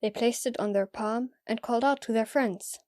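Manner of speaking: normal talking voice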